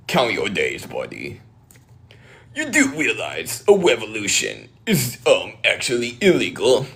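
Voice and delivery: swooping, put-on pedant voice; mock nerdy scold. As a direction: play a smug busybody issuing threats. Mocking scolding threats in character.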